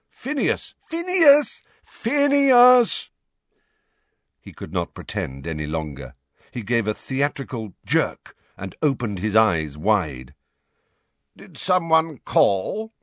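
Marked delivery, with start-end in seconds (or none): 0.1-3.1 high, loud chorus of shouts; shouting. A narrator's reading. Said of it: "some of them shouted his name too". 11.4-12.9 put-on higher, slow, drowsy old wizard; sleepy old portrait. A narrator's reading. Portrait feigns waking.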